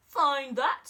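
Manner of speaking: loud scornful snap; sneering. Acted. Eagle taunting Horton over the clover field, loud sneer. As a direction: toss it at him with contempt. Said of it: "sneered the bird".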